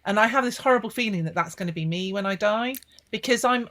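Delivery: usual speaking voice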